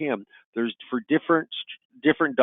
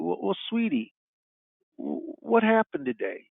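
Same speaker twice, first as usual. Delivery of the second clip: soft, higher, tender coaxing; tender parent; 6 semitones higher, 5 dB quieter than usual. Pretend. Parent soothing a crying teenage daughter, tender and soft. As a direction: gently coax a crying girl to talk.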